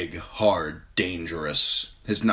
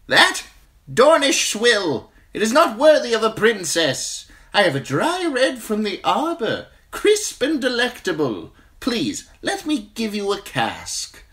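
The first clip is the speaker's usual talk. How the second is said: eager wine merchant; 7 dB louder than usual. A narrator's reading. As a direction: sell it to the crowd.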